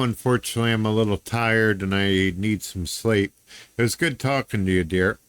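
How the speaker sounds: normal talking voice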